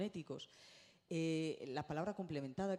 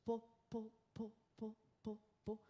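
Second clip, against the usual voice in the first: light, higher "po, po" for each bubble; bubble popping sounds; 6 semitones higher than usual. Shown as a demonstration. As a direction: pop the bubbles with your voice. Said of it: "vamos acompañándolas de sonido".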